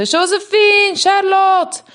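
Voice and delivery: high, loud frantic calling; screaming for the lost sisters; shouting names. Acted. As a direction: call the twins across the house in panic. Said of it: "schreeuwde ze uit".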